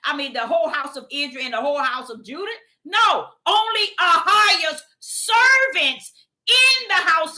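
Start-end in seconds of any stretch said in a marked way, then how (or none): 2.8-7.4 loud, high, slow shout; slow booming correction from the pulpit; preacher's shout. Real, not acted. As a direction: cry it out to the whole church.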